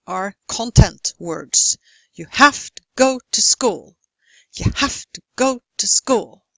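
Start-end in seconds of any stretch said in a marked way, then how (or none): 2.1-4.0 have, go and school punched, to swallowed; strong stressed rhythm. Shown as a demonstration. Teacher shows content words, have, go, school stressed. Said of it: "almost swallow the function words". 4.5-6.4 have, go and school punched, to swallowed; strong stressed rhythm. Shown as a demonstration. Teacher shows content words, have, go, school stressed. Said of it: "almost swallow the function words".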